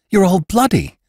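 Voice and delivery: high-pitched cry of alarm; frightened cry. Acted. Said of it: "cried Jane in affright".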